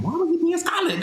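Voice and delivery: high, squeaky puzzled listener; mock listener voice. Host voicing curious listeners at home, high mock puzzlement. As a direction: play a baffled fan at home. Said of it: "Y'all at home asking".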